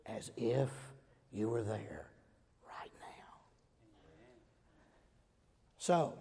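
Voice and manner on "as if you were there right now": whisper. Real, not acted. Preacher whispering assurance of heaven to the congregation. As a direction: trail off into a whisper. Closing a hushed phrase.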